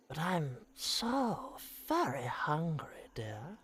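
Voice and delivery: slow whispering witch voice; slow creepy witch whisper; Baba Yaga. Acted. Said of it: "That Baba Yaga voice".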